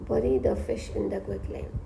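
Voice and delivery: very low, soft and slow; hushed, drawn-out mumble; murmured while writing. Real, not acted. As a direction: murmur it to yourself as you write. Murmuring words while writing them down.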